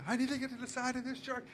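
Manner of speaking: high, soft, strained self-talk; panicked inner voice. Retold or imitated. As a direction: squeak it out in a panic underwater. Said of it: "So I'm like".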